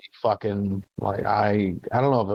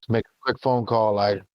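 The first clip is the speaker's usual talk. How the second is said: ordinary talk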